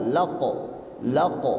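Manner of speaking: "laq" released at once into a clear short o; audible bounce. Shown as a demonstration. Teacher demonstrates to pupil, "laq" with bouncing q. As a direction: let "Laq" echo with an o. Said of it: "pantulannya terdengar jelas suara o".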